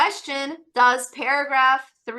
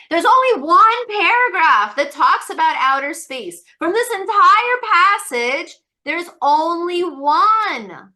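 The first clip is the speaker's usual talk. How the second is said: higher and louder, slowing on only one; emphatic insistence; 5 semitones higher, 7 dB louder than usual. Real, not acted. Teacher insisting loudly there's only one paragraph. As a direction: drive home only one.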